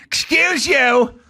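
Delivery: high, drawn-out indignant call; indignant stranger's voice. Retold or imitated. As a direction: stretch it out in a high, offended voice. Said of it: "This bitch sitting up there".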